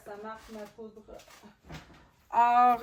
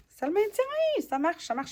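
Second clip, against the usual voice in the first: high, loud burst of delight; real excitement; 10 semitones higher, 6 dB louder than usual. Real, not acted. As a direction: exclaim it as the piece finally holds. Glued piece finally holds in place. (excité, tout content)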